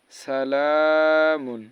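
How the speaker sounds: সালামুন said loud, each vowel drawn out slowly; stretched vowel. Shown as a demonstration. Teacher demonstrating the pull in সালামুন. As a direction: draw out সালামুন, holding the long vowel. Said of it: "ওখানে শুধু এক আলিফ পরিমাণ টান হবে".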